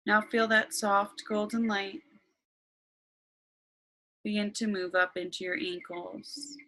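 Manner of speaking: slow, calm meditation voice; guided meditation. Acted.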